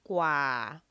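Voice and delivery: gua held low and level; Thai low tone. Shown as a demonstration. Teacher modelling gua with a low tone. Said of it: "So that's low".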